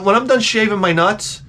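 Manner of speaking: ordinary delivery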